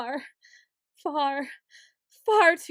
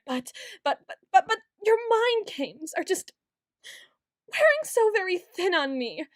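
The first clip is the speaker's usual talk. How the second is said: near-crying outburst; 4 semitones higher than usual. Acted. Voice cracks accusing.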